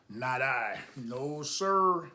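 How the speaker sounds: plain reading voice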